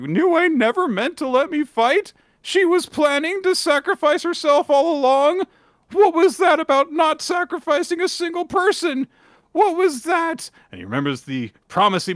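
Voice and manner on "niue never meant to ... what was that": high, louder falsetto in outrage; pissed-off Gakuro. Acted. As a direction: shriek the betrayal as the hero. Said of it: "really pissed off because he's like".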